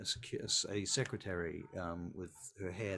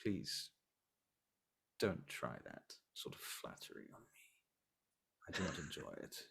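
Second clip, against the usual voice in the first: cold vampire prince; 3 semitones lower than usual. Acted. Prince rebukes flattery.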